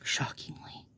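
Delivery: hushed whisper. Real, not acted. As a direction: breathe the word out softly. Whispered aside before laughing.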